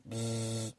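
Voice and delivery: soft imitated buzzing of a tattoo gun. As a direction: hum like a tattoo machine.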